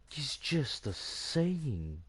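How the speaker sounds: slow, drawn-out exasperated reply. Retold or imitated. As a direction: spell it out wearily. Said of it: "I'm like".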